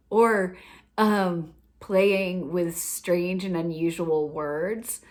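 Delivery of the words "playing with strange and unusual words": usual speaking voice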